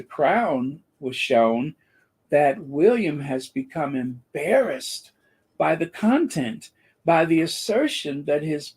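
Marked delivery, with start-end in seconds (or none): none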